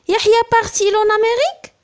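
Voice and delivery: high rising tune ending on Amérique, fast; rising question. Shown as a demonstration. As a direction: ask it, lifting Amérique at the end. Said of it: "l'inversion sujet-verbe".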